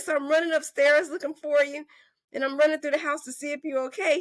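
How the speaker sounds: high, urgent retelling; frantic husband. Retold or imitated. Wife imitating husband searching the house for her, high and frantic. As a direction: voice a husband in a mild panic.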